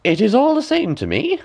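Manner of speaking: high crafty crone; high sly peddler woman; old woman. A narrator's reading. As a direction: shrug it off like a sly hag. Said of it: "answered the woman".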